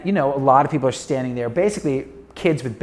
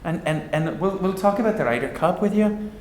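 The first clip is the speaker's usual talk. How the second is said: put-on a light Irish accent, higher, inviting; a hint of Irish, coaxing Billy to dinner; mildly voiced Irish golfer invite; 4 semitones higher than usual. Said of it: "in an Irish accent".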